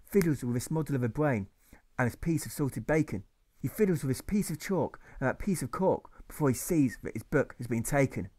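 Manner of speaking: plain reading voice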